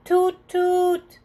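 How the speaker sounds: high, loud imitated horn toot; train horn toot. A narrator's reading. Pete honks the horn.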